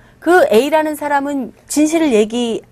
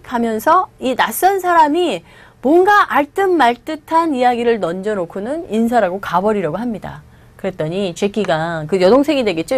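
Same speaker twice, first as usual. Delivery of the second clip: ordinary talk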